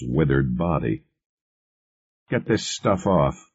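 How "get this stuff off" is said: crisp order pitched a little high; mildly commanding, higher voice; giving orders. A narrator's reading. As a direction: order the technicians about, without raising volume. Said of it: "he ordered his technicians".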